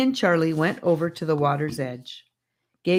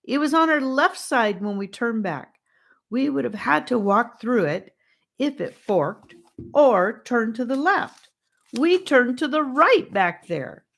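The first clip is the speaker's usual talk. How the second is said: higher, upset pleading; tearful insisting; 8 semitones higher, 4 dB louder than usual. A narrator's reading. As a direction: argue through tears. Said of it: "she sobbed".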